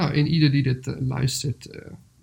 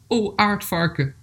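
langzame, hogere aanroep; voorgelezen aanroep; 6 semitones higher, slower than usual. Acted. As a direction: spreek het dier gedragen aan, met dalende toon. Dieren spreken aardvarken aan in verhaal. (hardop aanroepen in voorleesstem)

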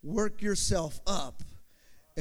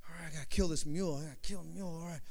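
psyching himself up; 2 semitones lower, 7 dB quieter, faster than usual. Acted. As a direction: talk yourself into it under your breath. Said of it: "Starts building it up".

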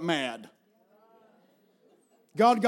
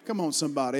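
ordinary delivery

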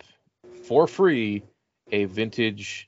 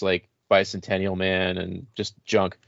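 ordinary talk

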